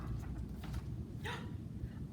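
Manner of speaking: sharp breathy gasp of surprise; surprised gasp. A narrator's reading. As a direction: gasp at the reveal. Surprise at monkeys.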